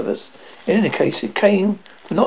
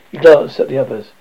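ordinary delivery, nothing marked

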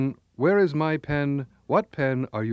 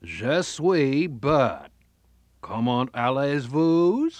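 New England French; much slower than usual. Acted. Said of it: "Let's Learn New England French".

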